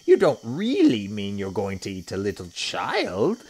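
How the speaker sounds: loud, incredulous hippo question; Humpy Rumpy. A narrator's reading. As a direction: ask loudly, disbelieving, as a shocked hippo. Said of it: "said Humpy Rumpy".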